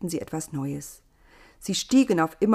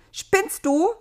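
much higher, loud teasing squeal; laughing Eva teasing; 12 semitones higher, 6 dB louder than usual. A narrator's reading. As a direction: tease him, amused. Das Wesen lachte schon wieder.

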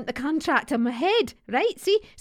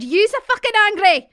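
much higher, much louder furious yell; angry shout; 9 semitones higher, 9 dB louder than usual. Acted. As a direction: bellow it so she hears. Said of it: "Would you speak up?".

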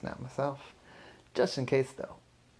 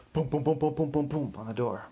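booming knock imitation; mock heavy knocking; door pounding; 2 semitones higher than usual. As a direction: pound it out like a fist on wood.

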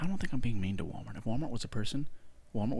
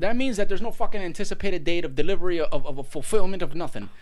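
higher, louder exasperated rant; 9 semitones higher, 8 dB louder than usual. Real, not acted. To Jasmine about Walmart, an angry rant. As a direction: let the anger boil over.

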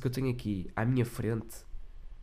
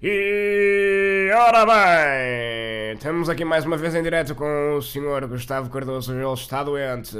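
live TV presenter; 8 dB louder, slower than usual. Acted. Mock live TV host intro.

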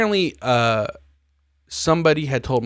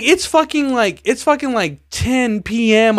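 ordinary talk

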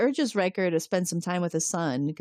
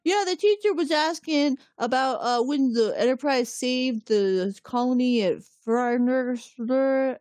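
put-on kid voice; 6 semitones higher than usual. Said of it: "He's like".

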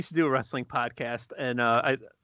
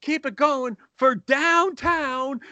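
mock MC building from higher pitch to a drawn-out shout; stage MC introduction; 10 semitones higher than usual. Host bringing the comic to the stage, hammed-up announcer build. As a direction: build the hype, stretch the final name high. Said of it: "So he gets up on stage".

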